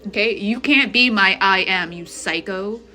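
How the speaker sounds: ordinary delivery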